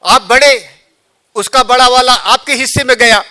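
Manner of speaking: high, loud, laughing eagerness; fired-up Lakshman. Acted. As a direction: boast you'll finish him off. Lakshman voiced in katha.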